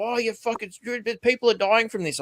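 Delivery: high mock voice of a scolding critic. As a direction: mimic them high and sneering. Mocking voice of scolding people.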